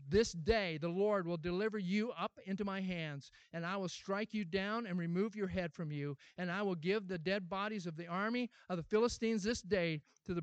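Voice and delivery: reads Goliath verses to congregation, then solemn reading; scripture reading. Acted. Declaim the verses like a proclamation. Pastor reads the David passage aloud.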